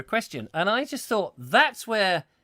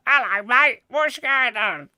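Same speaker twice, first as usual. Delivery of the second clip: high, loud, slow nosy neighbour voice; a prying neighbour leaning over the fence; 9 semitones higher, 8 dB louder, slower than usual. Acted. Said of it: "went nosy neighbour".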